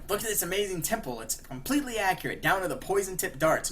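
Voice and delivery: playful imitation of a boastful host; showy boasting. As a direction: present it like a proud villain. Joker showing off his temple.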